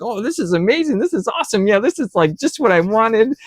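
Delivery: high, bright-eyed gush over the paycheck; thrilled. Retold or imitated. Young worker gushing to himself over his huge paycheck, high and thrilled. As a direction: squeal it like a kid with a prize.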